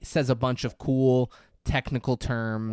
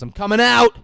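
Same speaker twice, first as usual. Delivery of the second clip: loud, high acted shout; defiant call; 10 semitones higher, 12 dB louder than usual. Stu announces he is leaving the booth, loudly calling out. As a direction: call it out at full voice.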